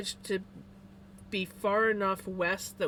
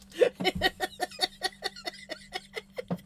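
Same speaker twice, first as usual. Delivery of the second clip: airy, much higher burst of laughter; high breathless laugh; 13 semitones higher than usual. Real, not acted. Laughing at the post about the male kitty, high and breathless. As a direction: laugh helplessly at the mix-up.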